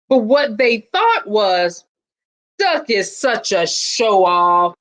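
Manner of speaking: put-on lively read-aloud for children; storybook reading. A narrator's reading. Principal reading a picture book to students, lively storytelling. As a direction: tell it like a picture book to a class.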